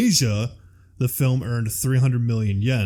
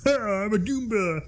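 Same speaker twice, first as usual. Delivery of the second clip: high, soft goofy creature voice; derpy Goomba; 9 semitones higher, 5 dB quieter than usual. Acted. Host mocks the movie's tiny-headed Goomba, in derpy high voice. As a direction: play a dopey little monster, high and quiet. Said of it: "he's just like".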